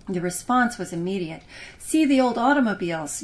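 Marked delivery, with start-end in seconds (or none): 1.8-3.2 put-on louder eager kid yell. Boy shouting his choice to parents, loud eager yell. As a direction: call out to be heard first. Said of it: "yelled Simon".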